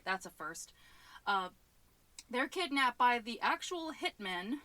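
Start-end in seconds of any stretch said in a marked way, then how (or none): none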